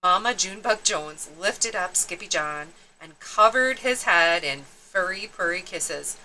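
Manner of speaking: ordinary delivery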